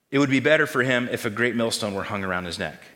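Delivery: normal talking voice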